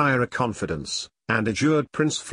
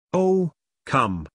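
higher-pitched, mild exclamation; crying out; 4 semitones higher than usual. A narrator's reading. President protesting to the prince before their sword duel, mildly crying out. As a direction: lift the pitch in a restrained cry. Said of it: "cried the president".

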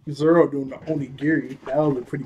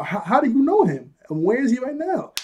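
excited, wide-eyed asking; eager questioning; 9 semitones higher, 4 dB louder than usual. Retold or imitated. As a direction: pitch leaps high on the questions. Luffy eagerly questions Buggy.

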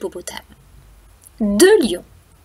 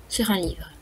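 ordinary delivery, nothing marked

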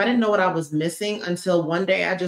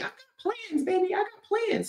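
high playful boast; 14 semitones higher, 6 dB quieter than usual. Acted.

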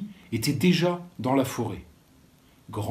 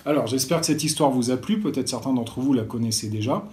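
ordinary delivery, nothing marked

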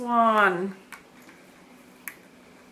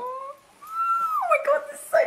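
delighted squeal. Real, not acted. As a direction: squeal over something adorable. Sees cute lipstick packaging.

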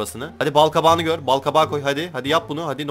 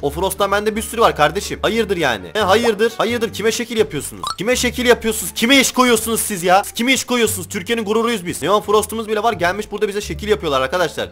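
high-pitched indignant rant; worked-up anger; 6 semitones higher than usual. Real, not acted. Player telling off traders who show off at them, indignant. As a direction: challenge them, voice raised in pitch and irritated. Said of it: "Gerçekten çok sinir oldum".

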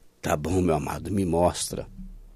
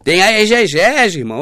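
high, slow mocking voice of a know-it-all; mocking the critics; 5 semitones higher, 7 dB louder, much slower than usual. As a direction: parody the opponent drawing out exegese. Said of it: "Aí eles viram pra mim e falam".

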